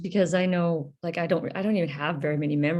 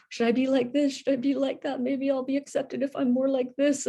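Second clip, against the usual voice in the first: higher, self-doubting inner voice; anxious inner voice; 7 semitones higher than usual. Retold or imitated. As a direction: voice the worried self asking how to fit in. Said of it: "trying to".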